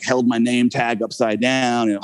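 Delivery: ordinary delivery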